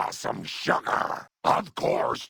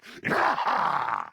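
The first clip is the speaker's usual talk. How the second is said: wild granny whooping cackle; battle cackle. Acted. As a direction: cackle gleefully, ready to go chopping. Same battle cry before chopping.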